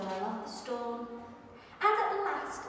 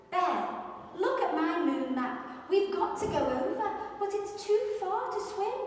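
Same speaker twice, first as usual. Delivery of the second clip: higher, louder anxious mouse voice; worried little mouse; 6 semitones higher, 4 dB louder than usual. Acted. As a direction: urgently show the map to Bear.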